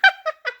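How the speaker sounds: sudden high burst of laughter. Real, not acted. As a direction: crack up at your own misery. Laughs at their own bad start.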